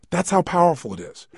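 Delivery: ordinary delivery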